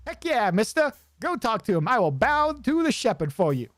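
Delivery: put-on high, chipper rodent kid; Sid voice. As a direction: bounce it out like an eager kid. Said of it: "I like voicing Sid".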